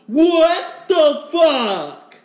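drawn-out, high, loud what the fuck; shocked outburst. Real, not acted. Reacting to the news with a loud, drawn-out shock. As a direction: stretch it out in loud disbelief.

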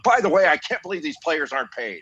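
high-pitched mock indignation; mock outburst. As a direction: blurt out a hammed-up complaint mid-commentary. Mock outburst interrupting game commentary.

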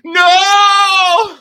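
loud, high, drawn-out scream. Retold or imitated. Retelling seeing the other Loki, screamed no. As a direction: re-enact a long, high scream of no. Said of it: "I literally started screaming".

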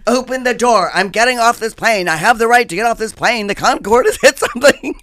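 hammed-up hysterical insistence; frantic yelling. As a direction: yell at the crew to be let off. Retold demand to leave plane.

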